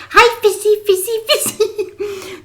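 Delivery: put-on very high childish coo, laughing; little girl from Nemo. Darla from Finding Nemo calling the fish, high cooing. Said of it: "That little girl at the dentist's office".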